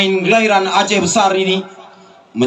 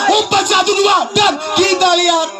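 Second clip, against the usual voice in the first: excited commentary; 10 semitones higher than usual. Real, not acted. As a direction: ride the passing move excitedly. Quick passing move.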